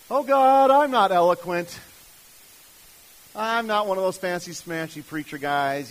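hammed-up objection; protesting excuses. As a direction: play a reluctant man making loud excuses to God. Acting out Moses making excuses to God.

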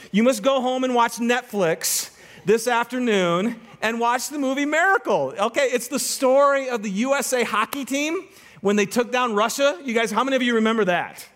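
ordinary delivery

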